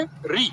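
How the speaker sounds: "री" with a long ee vowel; pronounced "री". Shown as a demonstration. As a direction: say "री" as a clear ree. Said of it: "री बोलोगे हमेशा".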